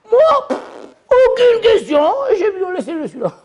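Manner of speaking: high carefree voice; carefree man. Acted. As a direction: act unbothered. Man with no questions.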